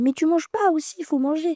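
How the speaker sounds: big sister gently scolding her sick sister to eat, mild scold. Retold or imitated. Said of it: "Moi je la dispute un peu".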